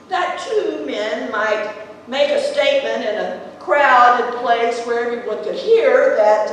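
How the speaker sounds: ordinary talk, nothing marked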